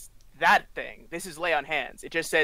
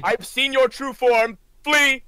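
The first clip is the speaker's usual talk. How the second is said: slow, raised, declaimed command; yelled banishment; 7 semitones higher, 6 dB louder, slower than usual. Acted. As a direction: proclaim it loudly, ending in a high shout. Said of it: "like, yells at her".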